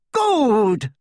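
high, loud scornful laugh; mocking laugh. A narrator's reading. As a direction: spit the word with a laugh. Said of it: "laughed Bellatrix".

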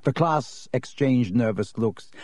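plain reading voice

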